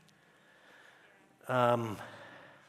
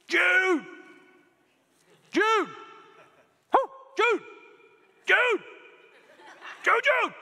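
high, loud call across the yard; 6 dB louder, slower than usual. Retold or imitated. Dad calling his son over the log splitter, loud call. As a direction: yell the name to get his attention. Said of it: "the louder I got".

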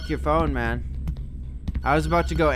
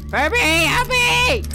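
high loud slow plea for help; panicked cry; 6 dB louder, slower than usual. Real, not acted.

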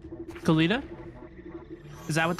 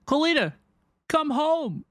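high, loud call; fan calling out; 6 semitones higher, 4 dB louder than usual. Real, not acted. Streamer calling the character home, loud excited call.

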